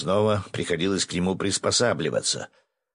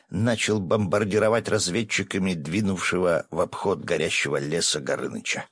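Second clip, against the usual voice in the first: plain reading voice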